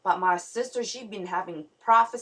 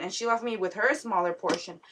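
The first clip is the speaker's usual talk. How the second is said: normal talking voice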